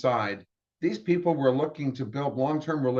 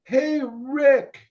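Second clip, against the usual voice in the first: a big booming shout across the room, pitched way up; booming call; 13 semitones higher, 6 dB louder than usual. Retold or imitated. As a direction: call out loud to a colleague across a trade show. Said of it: "I hear this booming".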